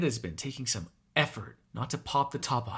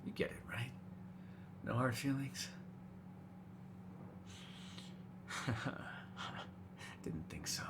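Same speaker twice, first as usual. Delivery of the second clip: low, very soft murmur to prey; barely-voiced predatory coaxing; hushed coaxing; 4 semitones lower, 16 dB quieter than usual. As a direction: lull them softly, intimate and in control. Coaxing prey before a bite.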